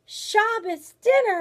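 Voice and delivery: high, loud, drawn-out thrilled cry; exclaiming. Acted. Bear invited to dinner by grandmother, excited exclamation. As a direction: burst out with delighted surprise. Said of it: "exclaimed the bear".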